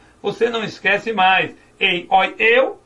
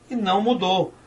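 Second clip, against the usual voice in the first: ordinary talk, nothing marked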